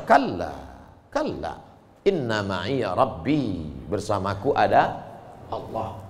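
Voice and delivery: low, soft and slow; calm assurance. Acted. Musa calmly answers his frightened people. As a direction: settle their fear gently.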